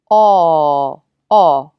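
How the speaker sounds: "aw" held long and loud with rounded lips; held aw vowel. Shown as a demonstration. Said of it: "See how the lips round".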